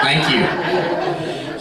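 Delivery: lightly mimicked Southern thank-you; Southern accent. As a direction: imitate a guest's Southern accent. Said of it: "That's a Southern accent".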